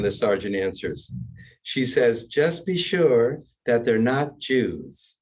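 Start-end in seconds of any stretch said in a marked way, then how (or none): 2.2-5.2 slow, drawn-out Southern matron; Southern matron accent. Acted. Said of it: "A Southern matron".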